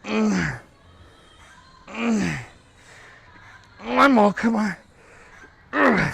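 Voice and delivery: strained high grunts into a loud push; psyching myself up. Real, not acted. Pushing through calf reps.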